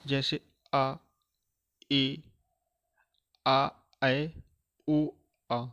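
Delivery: slow classroom vowel drill; vowel demo. Shown as a demonstration. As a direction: sound out आ ए ऐ ओ clearly like a teacher. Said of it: "देखते हैं कौन कौन से स्वर".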